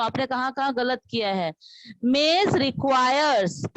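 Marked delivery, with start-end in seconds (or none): 2.1-3.8 very slow, word by word; slow model reading. Shown as a demonstration. Teacher reading slowly so students copy. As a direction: read it out slowly for the class to copy. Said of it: "ध्यान से सुना".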